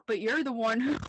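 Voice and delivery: giggling mid-quote; laughing through it. Retold or imitated. Piper's line dissolving into laughter.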